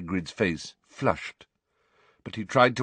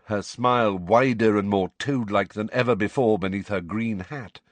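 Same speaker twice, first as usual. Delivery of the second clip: ordinary delivery, nothing marked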